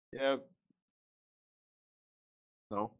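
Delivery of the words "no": ordinary delivery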